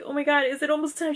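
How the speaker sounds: re-enacted excited anticipation; happy excitement. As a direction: rush it out as an eager question. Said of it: "I was so happy".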